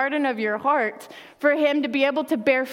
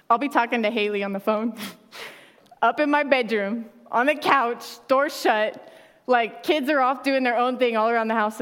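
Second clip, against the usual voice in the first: ordinary talk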